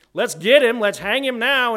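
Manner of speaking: loud, high, furious demand for a hanging; angry mob cry. Retold or imitated. David, worked up, loudly demanding the man hang. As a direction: shout it like a king out for blood.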